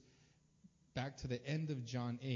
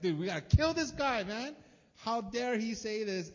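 loud, high-pitched acted fury; hammed-up outrage; 8 semitones higher, 9 dB louder than usual. Acting religious leaders who snap at Jesus, outraged shout. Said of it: "they snap".

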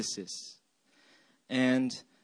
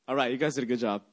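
usual speaking voice

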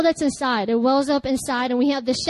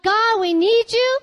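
louder, higher plea; shout to God; 7 semitones higher, 4 dB louder, slower than usual. Shown as a demonstration. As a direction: call out to God in need. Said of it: "you want to shout and tell God".

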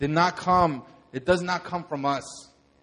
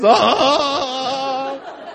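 higher, drawn-out cry; imitated cry; 10 semitones higher, 8 dB louder than usual. Pastor imitates the man crying out as he walks forward. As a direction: groan it out as he walks up. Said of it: "he starts walking up from the back like this".

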